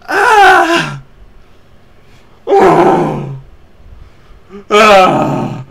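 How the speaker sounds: very loud, very high hammed-up wails; mock anguished screams. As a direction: scream like a terrible actor forcing emotion. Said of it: "Like that".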